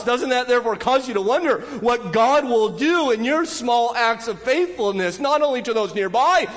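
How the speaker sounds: high, driving preacher's voice with urgent rhetorical questions; impassioned preaching. Acted. Preacher urging the congregation to wonder at God, impassioned.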